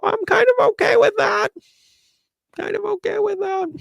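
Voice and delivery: very high, whiny put-on voice; mock reluctant acceptance. Reader mimics the ex grudgingly accepting, high and whiny. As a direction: squeak out a grudging okay. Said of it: "It's gonna be like".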